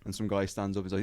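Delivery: usual speaking voice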